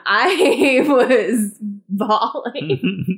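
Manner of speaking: loud, drawn-out words through laughter; amused, laughing confession of crying; laughing admission. Real, not acted. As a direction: stretch it out and break into laughing. Laughing over own crying.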